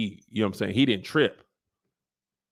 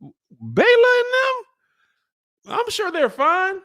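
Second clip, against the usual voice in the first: much higher, dazed and dismissive; clueless high squeak; 4 dB louder, slower than usual. Retold or imitated. Damon brushing off his dead wife, high and clueless. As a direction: play dumb in a high put-on voice. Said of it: "He was like".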